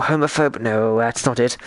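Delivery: ordinary delivery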